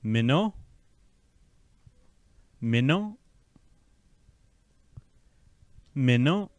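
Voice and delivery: "Minnow" with a rising end; "Minnow" said mee-no, lifting at the end; part drill. Shown as a demonstration. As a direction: model "Minnow" for the learner. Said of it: "pull these parts a little more together".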